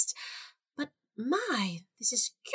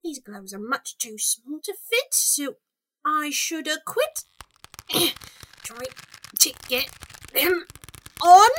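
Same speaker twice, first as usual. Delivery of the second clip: ordinary talk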